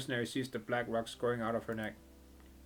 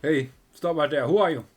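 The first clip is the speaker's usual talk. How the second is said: loud gang guard challenge; guard shout; 4 semitones higher, 11 dB louder than usual. Acted. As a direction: stop him at the door, loudly. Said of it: "the Blue Oblivion meme".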